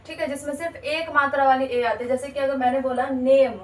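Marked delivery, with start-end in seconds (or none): 3.1-3.5 "नेम" said as naym with a long ay; example word name. Shown as a demonstration. Teacher giving the example word "नेम", stressed. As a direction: stress "नेम" with a clean ay vowel. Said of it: "जो ए है उसका क्या बना".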